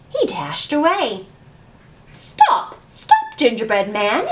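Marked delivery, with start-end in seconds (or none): 2.1-4.3 librarian crying out. Acted. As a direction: call out after the runaway. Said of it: "the librarian cried".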